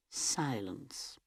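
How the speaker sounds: usual speaking voice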